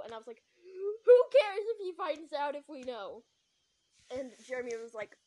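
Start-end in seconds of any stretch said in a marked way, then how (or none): 1.0-3.2 loud, high retort; exasperated shout. Acted. Answering worry that he finds out, exasperated shout. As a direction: burst out, falling away at the end.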